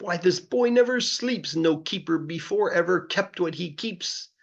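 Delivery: high, astonished crowd voice; gasping townsfolk. Acted. The whole town gasping at the boy's zoo, astonished. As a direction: marvel at the boy in a high voice.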